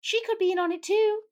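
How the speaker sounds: very high put-on boy-wizard voice; Harry. A narrator's reading. Harry suspecting the professor of conspiracy, very high voice. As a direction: pipe up as the suspicious young wizard. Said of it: "Harry observed".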